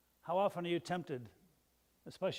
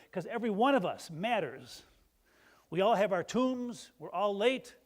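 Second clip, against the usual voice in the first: louder, rising preacher's call; fervent preaching; 3 semitones higher, 6 dB louder than usual. Real, not acted. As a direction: preach it hard to the pews. Preacher builds up to full force.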